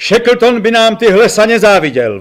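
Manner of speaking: high, loud boastful call. A narrator's reading. Ferry on the sled boasting to the others, loud call. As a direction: boast about the sled loudly.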